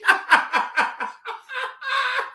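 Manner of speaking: very high, long cackle; helpless laugh. Real, not acted. Streamer tickled at the insult, helpless laugh. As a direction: laugh until it thins to a wheeze. Cracking up at being cursed out.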